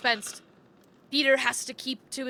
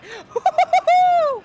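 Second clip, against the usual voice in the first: mocking laugh; 7 dB louder than usual. Mob jeers at the fight.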